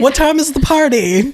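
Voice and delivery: high, loud mock mom voice; mom impression. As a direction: belt it out high as the nosy mom. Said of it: "especially if the mom".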